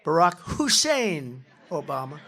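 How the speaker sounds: slow, loud, drawn-out "Hussein"; "Hussein" hammered in a slow radio-host sneer; stress on Hussein. Retold or imitated. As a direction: lean hard on "Hussein" like a talk radio host. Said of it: "He used to go".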